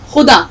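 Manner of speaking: خدا with a guttural kh; خدا, kh from the throat; correct pronunciation. Shown as a demonstration. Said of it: "تب یہ صحیح تلفظ خے کا آئے گا". (خے کے ساتھ صحیح تلفظ)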